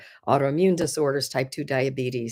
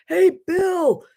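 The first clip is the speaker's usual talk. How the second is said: much higher, louder call across the whole store; hollering across; 13 semitones higher, 4 dB louder than usual. Retold or imitated. Imitating the store clerk calling to Bill across the store, loud holler. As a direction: holler like a clerk shouting to a coworker far away. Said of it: "he hollers across".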